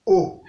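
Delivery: O on a short low tone. Shown as a demonstration. Teacher showing the tones, O on low tone. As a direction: drop O to the low tone. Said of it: "ton bas".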